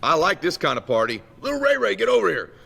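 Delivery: loud neighbor dad, rising to a high holler; Big Ray. Retold or imitated. Bully's dad calling his son to fight, loud eager holler. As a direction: relish it, then holler for your kid. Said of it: "And he goes".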